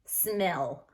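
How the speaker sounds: smill, short i; short I demo. Shown as a demonstration. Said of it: "The I would be short".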